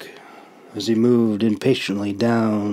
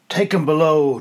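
ordering; 5 semitones higher than usual. Acted. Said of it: "Drossik ordered".

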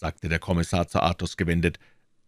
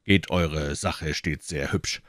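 ordinary talk, nothing marked

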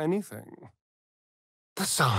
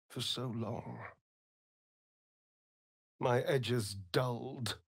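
much softer, words slowed and hollow; soft, numb confession; 8 dB quieter than usual. Acted. Vampire companion confessing years of numb captivity, soft and hollow. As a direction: let the old numbness hang on each word.

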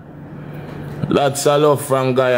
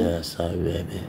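soft apology; 5 semitones lower, 11 dB quieter than usual. Real, not acted. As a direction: murmur it tenderly.

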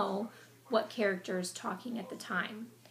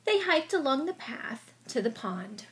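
story narration; 7 dB louder than usual. A narrator's reading. Dramatic read-aloud narration.

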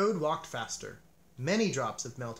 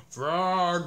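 loud, drawn-out spooky call; a muffled monster calling for Frog; a loud, slow ghostly call; eerie call; 6 dB louder than usual. A narrator's reading. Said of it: "cried the thing".

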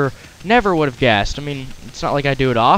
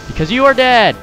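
gloating shout; 7 semitones higher, 5 dB louder than usual. Acted. Taunting a defeated enemy.

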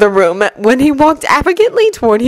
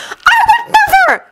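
outraged shout. Acted. Hermione denying she'd torture her cat, outraged shout. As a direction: blurt it in shock and fury. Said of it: "Hermione shouted in outrage".

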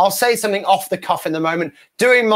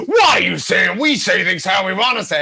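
fuming crowd; 4 semitones higher than usual. Retold or imitated. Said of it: "they're all fuming at me".

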